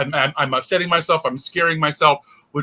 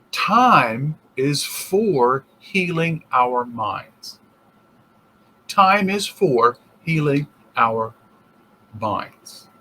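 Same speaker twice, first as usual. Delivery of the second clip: slow emphatic repeat; 3 semitones lower, much slower than usual. Acted. Slow repeated teaching line.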